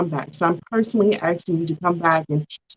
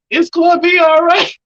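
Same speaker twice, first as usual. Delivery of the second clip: high, loud put-on voice; hammed-up high imitation of a comforter; imitated reassurance; 10 semitones higher, 6 dB louder than usual. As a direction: mimic someone comforting you, pitched way up. Acting out what he told her.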